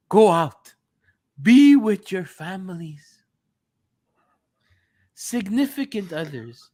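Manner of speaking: mock-emotional fan speech with long dramatic pauses; emotional Juventini. Reading an emotional tweet to co-hosts on request, mock-emotional Juventini voice. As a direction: read it like a wounded Juventini, halting between phrases. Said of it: "read it in your emotional Juventini voice".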